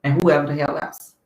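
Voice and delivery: soft, low, courteous address; quiet put-on politeness; mock polite. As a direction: politely get a lawyer's attention, hushed. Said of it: "I would have politely said".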